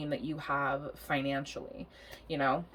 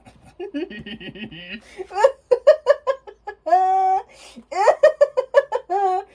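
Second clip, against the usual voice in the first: high, loud helpless cackle; real uncontrolled giggling fit; long laughing fit; 10 dB louder than usual. Real, not acted. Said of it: "here's why I'm laughing".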